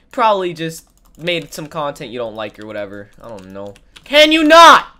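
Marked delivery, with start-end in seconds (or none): 4.0-4.9 loud, high, exasperated snap; annoyed shout. Real, not acted. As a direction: yell it at the pest. Annoyed outburst at another player.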